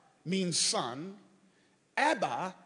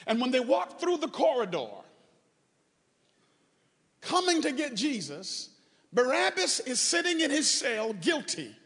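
raised, higher preacher's voice; impassioned preaching; 4 semitones higher, 4 dB louder than usual. Real, not acted. Preacher telling congregation of Barabbas spared, impassioned preaching. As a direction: preach it out with mounting force.

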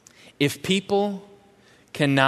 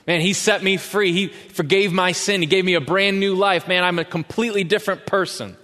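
raised, eager testimony voice; excited convert; 6 semitones higher than usual. Retold or imitated. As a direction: gush like someone just set free. Voicing a grateful new believer.

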